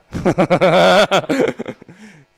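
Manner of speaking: loud, high delighted laugh; joyful laugh. Retold or imitated. Mahaprabhu recognizes the disciple with a loud, high, delighted laugh.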